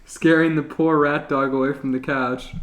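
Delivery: ordinary delivery, nothing marked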